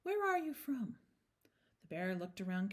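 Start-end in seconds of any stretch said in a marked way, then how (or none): 0.0-1.0 kindly lady. A narrator's reading. Mrs Brown talking to the small bear, kindly.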